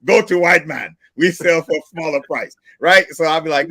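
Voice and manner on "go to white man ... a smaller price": higher, louder put-on voice with a foreign accent; mock sales pitch. Host acting out a restaurant owner's pitch, hammed-up. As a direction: hawk it in a half-joking pitch voice. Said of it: "And I'm like".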